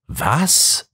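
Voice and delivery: high, questioning exclamation without real volume; mild mock cry. A narrator's reading. Inspector reacting to the detective's claim, a restrained cry. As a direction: read a cry of disbelief, kept restrained. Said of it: "schrie Mac Gordon".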